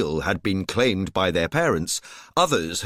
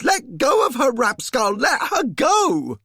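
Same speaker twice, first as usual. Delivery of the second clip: shrieking high and loud; screamed; 13 semitones higher, 4 dB louder than usual. A narrator's reading. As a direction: scream it at him in panic. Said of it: "A woman screamed".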